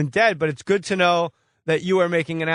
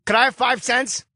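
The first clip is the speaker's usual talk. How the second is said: higher-pitched Boston street panhandler voice; Southie accent; 6 semitones higher than usual. Acted. Host mocking the caller as a Southie panhandler with a thick Boston accent. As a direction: beg for change like a homeless guy in Southie. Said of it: "You sound like a homeless guy in Southie".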